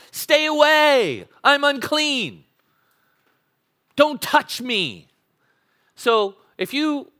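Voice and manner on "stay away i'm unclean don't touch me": high, slow shout of a leper keeping people away; leper's warning shout. Retold or imitated. A leper warning people away, slow high shout. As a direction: call out slowly, much higher and louder. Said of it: "you had to shout out to people".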